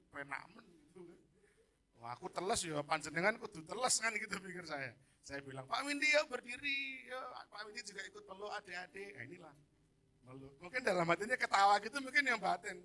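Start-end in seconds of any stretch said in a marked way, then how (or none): 5.7-9.1 calling out. Retold or imitated. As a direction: urge him loudly to join, then ease off. Calling a colleague to join in. (memanggil dengan nada tinggi)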